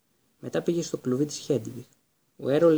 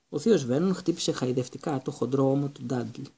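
ordinary delivery, nothing marked

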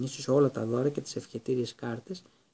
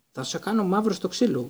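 uncle's raised, higher threat; yelled; 6 semitones higher, 4 dB louder than usual. A narrator's reading. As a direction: yell the threat at the boy. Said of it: "ούρλιαξε ο θείος Βέρνον". (ουρλιαχτό απειλής του θείου)

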